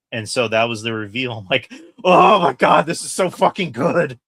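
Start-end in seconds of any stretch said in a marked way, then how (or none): 2.0-4.2 loud, high, excited shout; thrilled outburst. Retold or imitated. Fan reliving the big reveal, thrilled shout.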